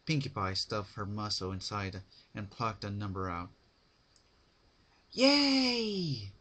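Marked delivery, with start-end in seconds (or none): 5.1-6.3 high, loud drawn-out cheer; Pinkie's cheer. Acted. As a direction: shout it with glee. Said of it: "she yelled".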